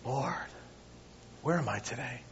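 soft, hushed, searching prayer; awed whisper. Pretend. Believer steps back and quietly asks God, hushed. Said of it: "step back and go".

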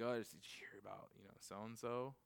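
hushed gossip whisper, quick and low; gossipy hush. Retold or imitated. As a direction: lean in and dish the gossip quietly. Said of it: "You're like".

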